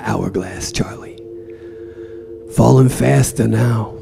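despairing Roma. Acted. Roma despairing to Charlie about the sand. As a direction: let the despair seep out.

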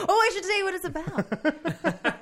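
high, slow, laughing through the words. Real, not acted. Actor laughs while plugging her own show. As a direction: crack up mid-sentence. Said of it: "laughing at herself".

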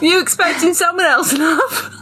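high-pitched cheeky British character; British accent. Acted. Reader repeating the stranger's line for laughs, hammed-up British accent. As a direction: ham up the British on "love". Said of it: "I need to do British".

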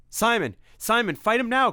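very high loud cry; desperate shout; cried out. Acted. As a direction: scream at him to fight. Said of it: "cried Tim".

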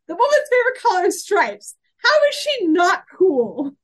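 high, loud fangirl outburst; gushing enthusiasm. Real, not acted. Gushing defence of a beloved character.

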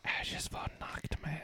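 hushed breathy reveal; dramatic whisper. Acted. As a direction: whisper the name like a secret. Announcer introducing guest dramatically.

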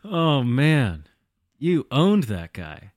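ordinary talk

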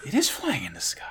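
hushed whisper of awe. Pretend. Recapping the Gundam's flight, awed whisper. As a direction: breathe it like a secret.

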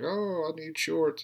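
very high, slow mock girl's whine; mock girly whine. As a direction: stretch it out high like a prissy teen. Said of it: "She's like".

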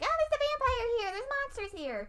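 put-on high, quick kid-like voice; little brother impression. Mimicking the little brother's vampire warnings, high-pitched mock alarm.

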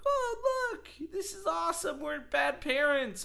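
very high, hammed-up commercial parent voice; over-the-top parents. Nineties commercial parents reacting, over the top. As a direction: gush it like cheesy ad parents. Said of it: "just over the top".

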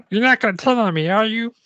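put-on high, small child's voice; high-pitched narrated girl character; little girl. A narrator's reading. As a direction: plead like a worried little kid.

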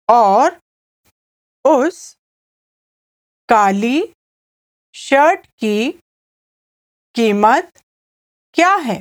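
small chunks with long pauses; slow repeat-after-me voice; slow repetition. Shown as a demonstration. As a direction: give it slowly, piece by piece, for a learner to echo. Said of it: "I'll say it again slowly".

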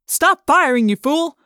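loud, high angry shout. A narrator's reading. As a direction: bark it out over the fight. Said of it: "someone shouted".

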